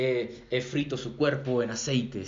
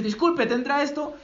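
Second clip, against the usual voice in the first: high, mock-polite queue jumper; pushy line-cutter; 11 semitones higher than usual. Preacher mimics a shopper cutting the line, high and pushy. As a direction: play the woman who cuts in, pitched up. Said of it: "se mete alguien y dice".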